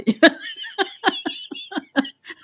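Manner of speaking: sudden high-pitched laugh; high burst of laughter. Real, not acted. Cracks up at the cat question.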